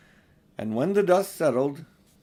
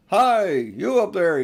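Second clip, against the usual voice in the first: slow, loud, higher roar; 6 semitones higher, 6 dB louder, slower than usual. A narrator's reading. Aslan calling up to the giant on the wall, roaring. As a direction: bellow it up to the giant. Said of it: "he roared".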